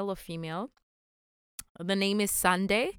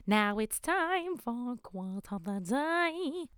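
slow announcer voice; 3 semitones higher, 4 dB quieter, slower than usual. Acted. Announcing segment in a voice.

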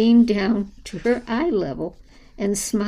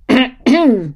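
loud throat clearing; 4 semitones higher, 10 dB louder than usual. Acted. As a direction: clear your throat loudly. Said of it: "cleared his throat".

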